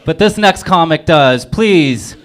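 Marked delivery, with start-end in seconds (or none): none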